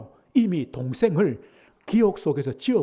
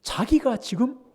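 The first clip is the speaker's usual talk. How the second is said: ordinary talk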